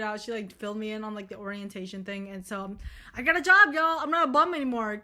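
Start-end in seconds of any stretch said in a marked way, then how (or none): 3.2-5.0 excited announcement. Real, not acted. Announcing job to viewers.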